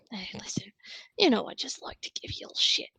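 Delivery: teasing conspiratorial whisper; playful halfling informant whisper. Acted. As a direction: whisper it like an old friend ribbing.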